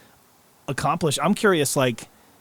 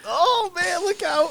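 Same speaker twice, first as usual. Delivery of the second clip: much higher, cartoonish kid voice; high mock kid voice; slower than usual. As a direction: squeak it out like a startled kid. Mimicking a kid at the shop.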